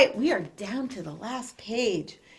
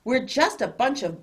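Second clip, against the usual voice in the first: ordinary delivery, nothing marked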